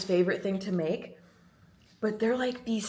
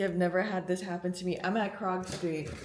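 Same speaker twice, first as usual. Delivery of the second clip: ordinary talk, nothing marked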